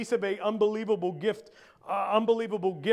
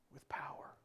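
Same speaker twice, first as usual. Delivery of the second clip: voiceless, very soft close; hushed awe; 19 dB quieter than usual. Real, not acted. Preacher finishing a promise about God's word, whispered. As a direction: drop to a whisper.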